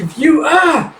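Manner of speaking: high, cut off by a squeal; squealing while being tickled. Retold or imitated. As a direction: break into a yelp mid-word.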